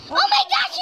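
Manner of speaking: high panicked shriek; screaming while falling. Real, not acted. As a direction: yelp while dropping. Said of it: "Nahulog ako!".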